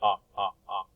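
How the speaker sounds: teacher modelling a short O vowel; short O sound. Shown as a demonstration. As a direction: open short "ah" for O, as in dot. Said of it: "short O sound".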